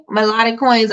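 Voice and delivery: ordinary talk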